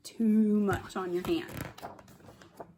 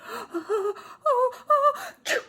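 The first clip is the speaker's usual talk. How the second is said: mock sneeze; 5 dB louder than usual. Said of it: "Make sure it's a vampire sneeze".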